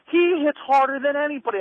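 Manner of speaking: high, loud put-on Dusty voice; lightly hammed-up Dusty scoffing; imitating Dusty. Dusty telling the kid about Terry, high put-on voice.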